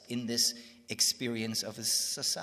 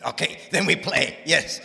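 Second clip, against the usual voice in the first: loud, high imitation of a joyful teacher; delighted agreement; 6 semitones higher, 13 dB louder than usual. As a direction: exclaim it with gleeful approval. Swami's delighted agreement, retold.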